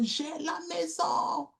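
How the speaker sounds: cri d'enfant aigu, bras au ciel; lance-le vers le ciel comme l'enfant, haut perché; cri de joie imité. Retold or imitated. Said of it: "il crie tellement fort". (cri d'enfant imité, aigu)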